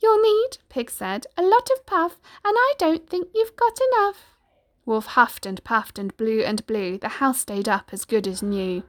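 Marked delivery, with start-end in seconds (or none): 0.0-0.6 high, loud piggy voice; little pig, taunting. Acted. Pig taunting the puffing wolf, high squeaky defiance. 1.4-4.3 high, loud piggy voice; little pig, taunting. Acted. Pig taunting the puffing wolf, high squeaky defiance.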